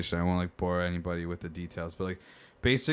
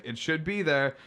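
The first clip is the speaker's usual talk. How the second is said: high-pitched retold back-and-forth; mock customer dispute; 9 semitones higher, slower than usual. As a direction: pitch it up like a protesting caller.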